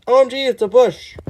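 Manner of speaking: loud, high, amazed reading; overexcited OMG. Acted. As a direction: hype it up like a shocked fan. Rereads the line louder.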